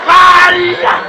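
hlasitý výkřik, o hodně výš; vyřvi to jako šílenec; wild shout. Pretend. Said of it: "Jste se zbláznil". (zachránce šíleně zařve)

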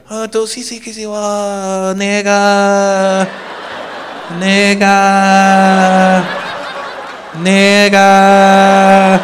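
put-on long drawn-out, held syllables of an unsure child; Chinese kid impression. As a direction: imitate a little Chinese kid stalling in Mandarin. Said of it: "this little Chinese kid runs in".

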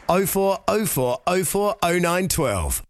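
ordinary talk

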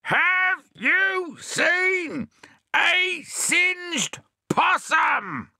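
very high, very slow, loud shout; tremendous shout. Acted. Bill shouting at the deaf hedgehog in a huge voice. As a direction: blow his own hat off with it. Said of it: "yelled Bill in such a tremendous voice".

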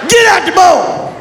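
high, loud drawn-out yell; grandpa yell. Retold or imitated. Grandpa yells at the cat, high and loud. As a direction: bark it at the cat. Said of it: "After he yell at something".

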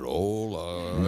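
HOLA very low and stretched out; imitated drawled hola. Kid answering dad with the hola, low drawled imitation. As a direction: mimic a child parodying the host's slow greeting. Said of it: "y hace".

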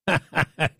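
short delighted laugh, high; amused laugh. Real, not acted. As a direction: burst out laughing at the jab. Host laughs at guest's jab.

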